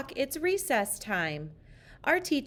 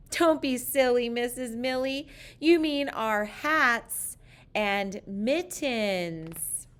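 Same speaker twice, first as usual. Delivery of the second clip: slow, higher chiding chorus voice; playful kids' scolding, slowed down; children correcting teacher; 4 semitones higher than usual. Acted. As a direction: drag it out like a class teasing teacher.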